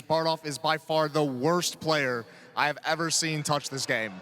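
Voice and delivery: ordinary talk, nothing marked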